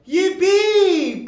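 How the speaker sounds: high, joyful cheer. Acted. Derek cheering for the sleigh ride. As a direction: yelp with delight. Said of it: "cried Derek".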